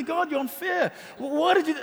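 high, pleading complaint to God; a high, hurt protest that God is unfair; complaining Job. Acted. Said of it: "And he starts complaining".

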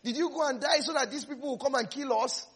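a lightly hammed-up grumble to the Lord; half-laughing mock protest; mock whining complaint. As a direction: play the complaining Israelites with a laugh. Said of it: "and said".

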